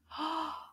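soft gasp of delight; quick quiet intake of surprise; surprised gasp. Pretend. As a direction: gasp at the little animal. Reveals little animal picture.

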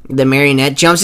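ordinary talk, nothing marked

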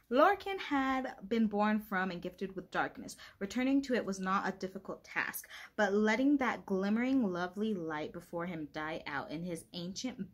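steady book narration sinking into a hush at the end; read aloud from the book. Acted. Reading a passage aloud to viewers, hushed ending. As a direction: read it out like a storyteller, letting it fade.

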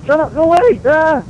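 scared squeal building to a yell; startled panic. Acted. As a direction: jump out of your skin and shriek at it. Startled by something in the room.

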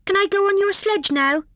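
high, small eager voice; little hedgehog asking. A narrator's reading. Hedgehog asks Hare for a ride, high piping. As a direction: pipe up hopefully.

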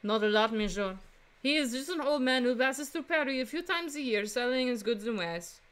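put-on Frenchwoman's French accent; female French accent. Flower seller answering a defense attorney, female French accent. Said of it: "do another female French accent".

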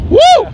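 high loud whoop of delight; joyful whoop. Real, not acted. Flier to viewers, a high whooping cheer. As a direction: let out a big happy cheer.

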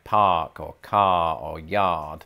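drawn-out Boston r-dropping. Shown as a demonstration. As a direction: stretch the Boston "ah" in each word. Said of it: "do a Boston accent".

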